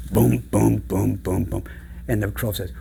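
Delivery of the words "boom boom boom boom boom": hint of thudding hoofbeats; lightly imitated heavy tread; heavy footsteps. Storyteller imitating medium goat's steps, lightly thudded booms.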